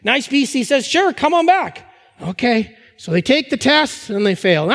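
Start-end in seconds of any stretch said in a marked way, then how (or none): 0.9-1.8 bright higher cheerful clerk voice; cheery clerk. Retold or imitated. Pastor voicing the licence office, cheerily inviting them back. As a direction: sing it out like a friendly desk clerk.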